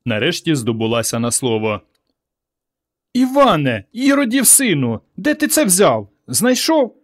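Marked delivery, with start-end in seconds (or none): 3.1-7.0 very high, drawn-out shocked questioning; scandalized mother's cry; alarmed mother. A narrator's reading. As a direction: demand answers in a high, horrified voice. Mother shocked at stolen money.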